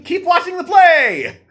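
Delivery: loud chanted cheer; shouting. Retold or imitated. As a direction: yell it like a crowd. Quoting chat as cheering.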